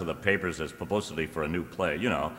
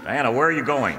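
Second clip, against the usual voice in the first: louder, higher call; calling after her; 7 semitones higher, 8 dB louder than usual. Acted. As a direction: call out as she leaves. Husband calls after leaving wife.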